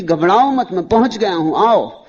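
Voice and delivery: high, loud call from afar. Acted. One who has arrived calls to those on this shore, calling out. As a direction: call across the water with loud reassurance. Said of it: "उस किनारे से कोई पुकार दे दे".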